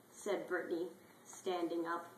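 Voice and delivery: ordinary talk, nothing marked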